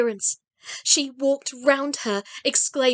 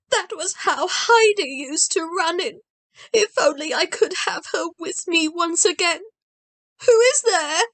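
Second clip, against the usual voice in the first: put-on old grandmother; 5 semitones higher, 3 dB louder than usual. A narrator's reading. Blind grandmother voiced.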